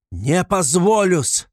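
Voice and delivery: loud, high, drawn-out outburst; full-force shout. A narrator's reading. Raskolnikov refusing to be mocked by the investigator, furious shout. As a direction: shout it slowly with all your strength. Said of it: "крикнул он вдруг изо всей силы".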